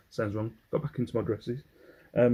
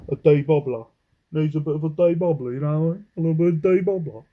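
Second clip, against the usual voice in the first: louder, hammed-up Cockney on "debobbler"; mock Cockney; 5 semitones higher, 8 dB louder, faster than usual. Seller joking about a jumper's bobbles, playful Cockney accent. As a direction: play up "debobbler" like a loud Cockney. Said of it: "debobbler sounds like, um, Cockney".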